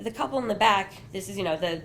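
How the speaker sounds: ordinary talk, nothing marked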